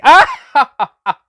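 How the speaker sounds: big high laugh; booming pleased laugh; hearty laugh. Acted. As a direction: laugh out loud, delighted with yourself. Laughs after introducing himself.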